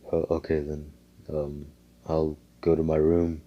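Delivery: soft, low, hesitant answer; a quiet, reserved boy; subdued reply. Acted. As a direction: mumble a polite agreement. Reserved boy answers his new mom.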